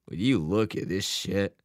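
groaning complaint at the TV; groaned. A narrator's reading. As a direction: groan it out in disgust. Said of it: "his dad groaned".

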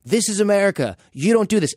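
old Korean store owner facing a mob, a hint of her high pleading voice; imitating a pleading old woman. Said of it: "and she goes".

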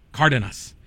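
Cardenas corrected, CAR-denas with the first A stressed; stress on first syllable. Real, not acted. Host correcting his own slip, Cardenas stressed on the first A. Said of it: "The accent's on the first A".

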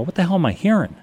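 jolted out of a daydream by a noise; startled. Retold or imitated. Man in bed hearing strange thumps, startled and baffled.

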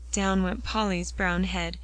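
usual speaking voice

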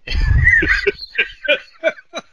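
loud, high burst of laughter; laughing. Real, not acted. Player cracking up at own accent attempt, laughing. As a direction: crack up loudly.

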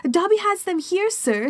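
high squeaky house-elf; a meek little elf confessing. Acted. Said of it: "said the elf".

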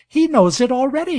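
high, loud delighted cry; cried out. A narrator's reading. Girl to her father about the dog, delighted cry. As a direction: burst out with glee. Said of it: "she cried".

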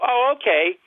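high, exaggerated sarcastic acceptance; mock sarcastic. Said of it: "So then I get really sarcastic".

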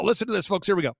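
ordinary talk, nothing marked

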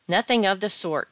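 loud, emphatic contradiction; cried protest. A narrator's reading. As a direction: exclaim it as a firm objection. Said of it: "cried his friend".

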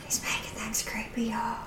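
conspiratorial whisper to the audience; stage whisper. Pretend. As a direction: whisper it to the listeners as a secret.

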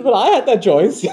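high, loud amused laugh through the words. Real, not acted. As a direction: laugh as you admit it. Laughing at daughter's reply.

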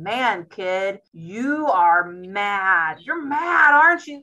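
name his anger back to him, big and clear; mirroring a mad toddler's feelings; parent naming a child's anger. Retold or imitated. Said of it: "I'm like".

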